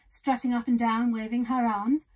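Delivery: plain reading voice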